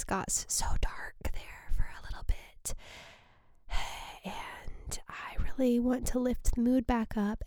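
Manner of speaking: soft unvoiced whisper. Real, not acted. Wrapping up the dark episode, soft whisper. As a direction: hush it like a secret. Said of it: "whispered aside".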